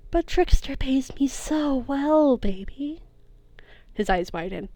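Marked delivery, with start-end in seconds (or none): 0.1-3.0 slow, sinister whisper; menacing whisper. Acted. Mother betrays son, whispered.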